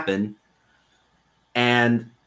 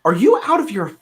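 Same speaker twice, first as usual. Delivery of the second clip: high, mock-furious dad; a raised, imitated angry father; dad yelling; 6 semitones higher than usual. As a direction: retell the dad blowing up at his son. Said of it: "he called me and he goes".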